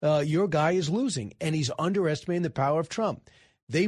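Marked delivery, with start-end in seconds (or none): none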